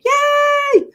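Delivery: squealed high, loud yay; excited cheer. Real, not acted. Host cheering a viewer who watched her live, squealing with joy. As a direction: cheer like she's thrilled at the news.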